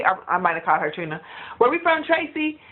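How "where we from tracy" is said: confrontational quote. Retold or imitated. Sister challenges [name] in a mock confrontational quote. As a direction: throw down the challenge. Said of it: "Those are fighting words".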